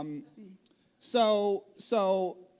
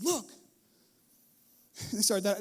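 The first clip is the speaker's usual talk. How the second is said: ordinary talk, nothing marked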